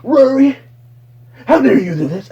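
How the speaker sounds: high, loud mock indignation; mock outraged address. Podcast host scolding an absent co-host's empty seat, mock outraged. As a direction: cry out at someone in hammed-up outrage. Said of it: "He's like".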